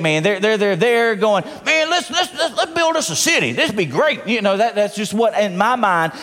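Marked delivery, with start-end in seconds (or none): 1.6-4.3 high, put-on regular-guy voice; excited Fred and Jake. Preacher imagines two builders planning a city, hammed-up high excited voice. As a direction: playfully voice an eager builder pitching a plan. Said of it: "I just look at Fred and Jake".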